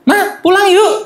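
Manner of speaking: put-on very high, pleading kid's voice; little child voice. Shown as a demonstration. Teacher showing a small child asking to go home, high and childlike. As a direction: squeak it out like a tiny child. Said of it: "kayak dah cilik ya".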